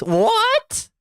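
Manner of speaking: very high squeaky "what". Retold or imitated. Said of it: "He's about to do the squeaky voice".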